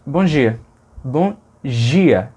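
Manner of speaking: bom dia with only a j, no d; just j. Shown as a demonstration. Teacher showing speakers dropping the d. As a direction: say dia as jia with no d. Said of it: "Dizem só".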